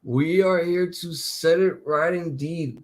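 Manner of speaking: ordinary talk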